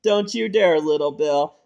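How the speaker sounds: ordinary talk, nothing marked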